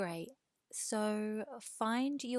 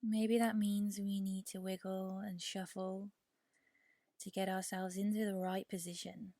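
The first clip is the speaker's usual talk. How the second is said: guided meditation. Real, not acted. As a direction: ease them into rest, unhurried and measured. Leads a yoga nidra meditation.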